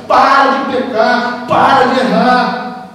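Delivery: each word of "Para de pecar" drawn out very slowly and weighted; slow emphatic preaching. Real, not acted. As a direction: pound the command home, slowly. Preacher hammering the call to repent. ("Para de pecar, para de errar" pregado bem devagar, palavra por palavra)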